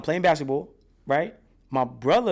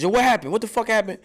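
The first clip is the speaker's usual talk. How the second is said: high, loud, demanding voice of the aunt; 8 semitones higher, 4 dB louder, faster than usual. Retold or imitated. Aunt confronting her beaten nephew, loud and demanding. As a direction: bark it out like an alarmed aunt. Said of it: "my aunt says".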